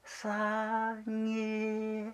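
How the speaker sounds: barely a call now, stretched out; much smaller call. Retold or imitated. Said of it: "最前よりももっともっと小さい声で". (もっと小さい声で「さんぎー」)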